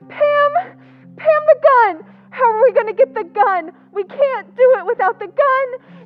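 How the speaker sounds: high, loud, tearful panic; panicked. Acted. Kathy frantically tells Pam they need the gun, panicking. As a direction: voice rising in frightened alarm.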